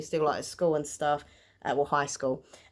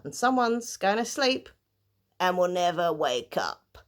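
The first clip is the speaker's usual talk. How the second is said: spooky trailer voice. Acted. Dramatic blurb ending read aloud.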